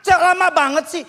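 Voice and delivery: high, irritated complaint; impatient child. Retold or imitated. Grown child hurries aging mother, high and impatient. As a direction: imitate a child fed up with waiting. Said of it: "Sekarang bilang".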